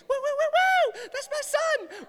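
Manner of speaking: high, loud whooping cheer mom; mom's cheer. Retold or imitated. Son mimics mom cheering him on at games, high and loud. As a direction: cheer from the stands like a proud mom. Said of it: "that is her, like, token phrase".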